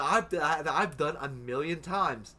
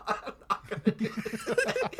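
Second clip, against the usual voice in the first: higher, laughing through the words; laughing repeat; 6 semitones higher than usual. Retold or imitated. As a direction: repeat it cracking up. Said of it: "favorite thing I ever heard you say".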